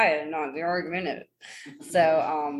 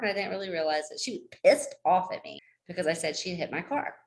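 normal talking voice

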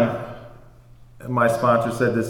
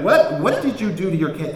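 mock scolding mom; 7 semitones higher than usual. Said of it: "chews me out for forty-five minutes".

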